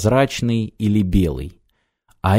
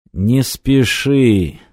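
slow, weary, labored words; heavy and slow; much slower than usual. Acted. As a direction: drag each word out with effort. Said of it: "тяжело вымолвил старик".

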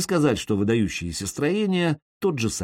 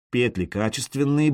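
usual speaking voice

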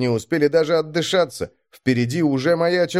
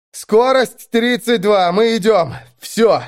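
loud, high, slow call-out; strained report; 10 semitones higher, 5 dB louder, slower than usual. A narrator's reading. Driver reporting on air with his last strength, strained. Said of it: "с трудом нашёл силы, чтобы доложить".

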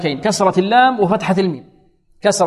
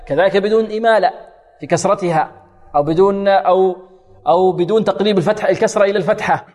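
ordinary talk, nothing marked

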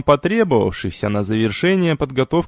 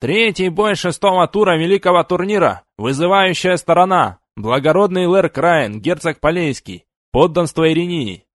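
herald's announcement; 4 semitones higher than usual. Acted. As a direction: announce it to the whole tournament field. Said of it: "голос Герольда объявил".

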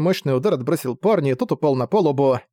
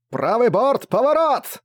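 high-pitched urgent command; shouted order; 9 semitones higher, slower than usual. Acted. Regul to his crew as the gust hits, shouted order. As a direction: call the turn out, pitch leaping up.

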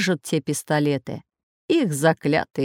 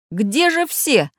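drawn-out, higher-pitched cry of alarm; 6 semitones higher, much slower than usual. A narrator's reading. Robot craftsman finds the children's beds empty, crying out. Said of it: "закричал Самоделкин".

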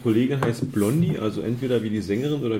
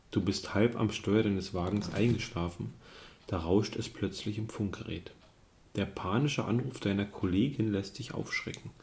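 story narration; 2 semitones lower, 8 dB quieter than usual. A narrator's reading. Liest Spielbuch vor.

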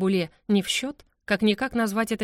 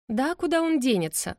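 higher-pitched, breezy assurance; confident; 6 semitones higher than usual. A narrator's reading. Bride dismisses grandfather's refusal, confidently. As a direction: brush the worry off confidently. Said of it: "уверенно ответила я".

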